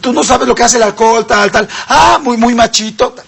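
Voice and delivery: burla aguda y lenta de papá enojado; regaña al hijo con sarcasmo, más agudo; padre sarcástico regañando. Retold or imitated. (papá imitado regañando al hijo que bebió)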